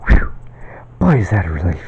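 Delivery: breathy whispered sigh of relief; relieved whisper. Retold or imitated. Survivor sighing with relief, whispered. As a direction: whisper it like the worst is over. Retold relief after hardship.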